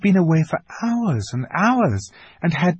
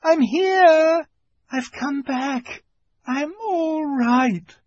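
calling out; 4 dB louder, much slower than usual. A narrator's reading. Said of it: "she shouted".

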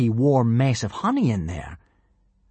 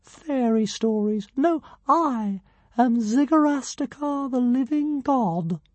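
much higher, slow and grand; pompous tiny dragon; 13 semitones higher, slower than usual. A narrator's reading. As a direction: announce yourself like a pint-sized deity.

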